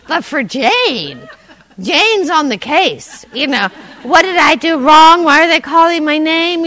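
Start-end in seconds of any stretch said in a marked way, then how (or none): 4.0-6.6 anxious inner voice. Retold or imitated.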